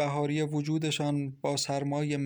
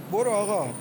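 urgent, raised call to a driver; hurried; 6 semitones higher, 5 dB louder than usual. A narrator's reading. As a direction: send the driver off, louder and higher. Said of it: "با عجله گفت".

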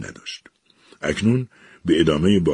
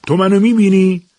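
shouted, much higher and louder; loud question; 11 dB louder than usual. Acted. Eliza shouts at the unseen voice, high and loud. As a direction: yell the question out into the empty room. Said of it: "با صدای بلند پرسید".

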